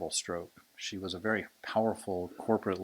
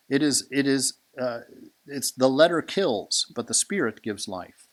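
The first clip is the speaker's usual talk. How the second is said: emphatic preaching; 5 semitones higher, 6 dB louder than usual. Acted. A preacher drives home the scripture to listeners, loud. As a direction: drive the line home from the pulpit.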